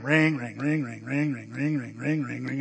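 low, rapid repeated ringing; imitated phone ringing. Wife calling back unanswered, imitated ringing. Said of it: "she's ringing".

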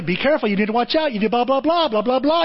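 high, quick mimicked warnings; nagging advisers. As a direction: rattle off warnings that nobody hears. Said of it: "People are trying to tell you".

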